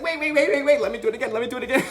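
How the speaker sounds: high, loud desperate begging; frantic pleading. Retold or imitated. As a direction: beg her to let you try. Pleading, laughing.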